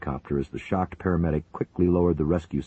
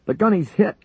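very high, loud alarm; shouting; 8 dB louder than usual. A narrator's reading. Paramedic shouting that the gunner is hit, alarmed. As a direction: yell the news in alarm. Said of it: "Lincoln shouted".

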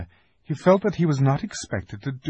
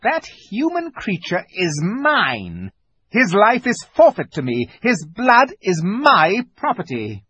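high, loud possessive witch; witch's claim; 11 semitones higher, 6 dB louder than usual. A narrator's reading. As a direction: stake a claim imperiously. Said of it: "continued the witch".

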